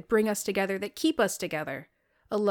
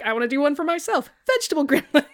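high, loud goofy pitch guy; high silly voice; 7 semitones higher, 5 dB louder, faster than usual. Retold or imitated. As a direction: squeak it like a kid. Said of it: "some man must have just walked in the room and be like".

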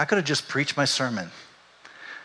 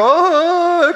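high, loud comic crying wail; mock wail; 9 dB louder than usual. Women in the church bursting into tears, hammed-up wailing. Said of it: "other women in the church are".

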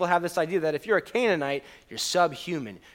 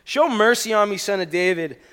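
loud, higher pleading cry; shouted plea; 6 semitones higher, 9 dB louder than usual. Acted. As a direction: cry out for mercy. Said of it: "and she shouted".

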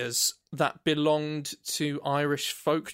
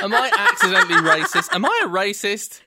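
laughing, louder and higher; 13 semitones higher, 8 dB louder than usual. Real, not acted. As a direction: laugh through the mock panic. Laughing at himself.

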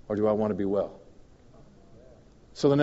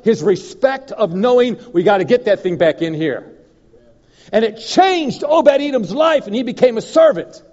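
loud, high, drawn-out preaching; preaching at full force; 9 semitones higher, 10 dB louder than usual. Real, not acted. Preacher to congregation on a changed life, full-force preaching. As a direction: drive it home at full volume, pitch raised.

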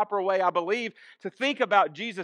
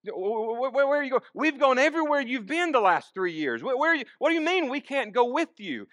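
growing anxiety; 3 semitones higher than usual. Acted. Disciple questioning his departing teacher, increasingly anxious. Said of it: "is an expression of anxiety".

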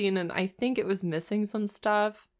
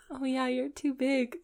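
mock flirty line; 5 semitones higher, 5 dB quieter than usual. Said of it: "I'll play the game".

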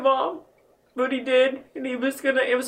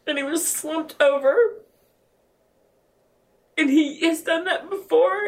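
ordinary delivery

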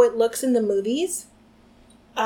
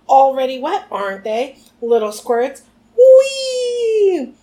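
gleeful Peeves; 3 semitones higher, 7 dB louder, slower than usual. A narrator's reading. Peeves gleefully bombing students, whooping. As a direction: gloat and shriek with delight.

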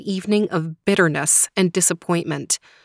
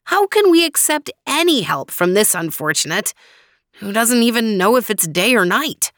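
put-on higher, incredulous young man's voice; pitched-up skeptical plea; doubting Faraz; 5 semitones higher, 3 dB louder than usual. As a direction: object with doubt to the wise friend.

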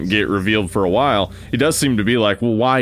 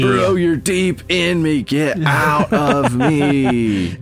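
very slow, higher haunted drawl; ghostly house voice; 4 semitones higher, slower than usual. Pretend. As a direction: stretch every word like a moaning ghost. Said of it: "the ghostly voice from the house goes".

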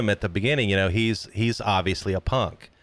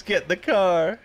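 half-voiced scream, pitched up; imitated scream; 7 semitones higher than usual. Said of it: "I love when he screams".

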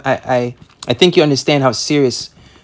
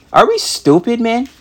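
exasperated; 8 semitones higher than usual. Real, not acted. Preacher exasperated.

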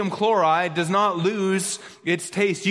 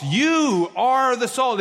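"you" held long at a raised pitch; slow, higher phrase driven by an emphatic "you"; stress on YOU; 5 semitones higher, slower than usual. Shown as a demonstration. Preacher repeating the verse for emphasis, stretched stress on "you". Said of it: "the emphasis on".

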